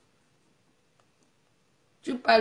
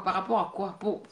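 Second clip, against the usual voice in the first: ordinary talk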